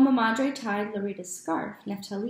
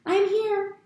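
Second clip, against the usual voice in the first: muffled from inside a trunk; 12 semitones higher, 7 dB louder than usual. Acted. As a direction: sound boxed in and far off. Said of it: "his muffled voice came".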